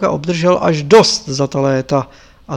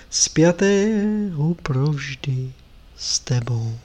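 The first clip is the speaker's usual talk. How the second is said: hushed, measured sacred verse; quiet devotional recitation; solemn prayer; 4 dB quieter, slower than usual. Acted. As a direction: recite reverently, with long pauses between phrases.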